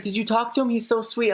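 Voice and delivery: high-pitched imitation of a keen friend; gushing friend. Friend urging the speaker to talk to Jake Paul, gushing imitation. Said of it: "he's like".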